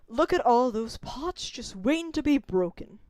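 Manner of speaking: normal talking voice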